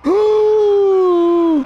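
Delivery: high, drawn-out shocked exclamations; stunned fright. Acted. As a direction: react in high-pitched horrified disbelief. Réaction au monstre terrifiant. (effroi stupéfait)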